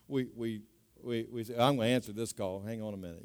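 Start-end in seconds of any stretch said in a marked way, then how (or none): 1.6-3.2 low, hushed mock excuse; quiet dismissive aside. Listener breaking off a talk for a call, quiet aside. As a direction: toss it off softly, much lower. Acting out a rude phone interruption.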